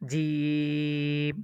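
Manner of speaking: জিম with the vowel held long and level; জিম, drawn out three measures, very slow; three-alif stretch. Shown as a demonstration. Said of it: "জিমটা তিন আলিফ টান হবে". (জিম তিন আলিফ টেনে দীর্ঘ উচ্চারণ)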